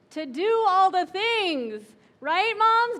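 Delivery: rally the moms with a punch on all; emphatic rallying. Real, not acted.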